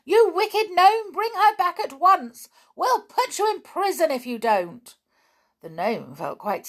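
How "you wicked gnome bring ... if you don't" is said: high, loud, indignant shouting; angry shout. A narrator's reading. Toy shouts at the gnome flying off with the doll. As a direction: shout him down, demand her back. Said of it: "began to shout".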